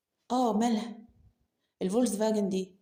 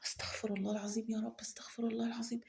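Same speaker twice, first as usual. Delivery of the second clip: much softer, fading to a whisper; hushed prayer; 9 dB quieter than usual. Acted. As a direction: murmur the plea for forgiveness. Ashamed muttered prayer for forgiveness.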